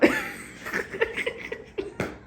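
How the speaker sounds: breathless high-pitched giggle; high squealing giggle. Real, not acted. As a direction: let a squeaky giggle escape. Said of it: "'cause I'm so giggly".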